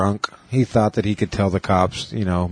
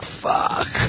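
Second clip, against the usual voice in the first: whispered curse; 3 dB quieter than usual. Retold or imitated. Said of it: "And he's like".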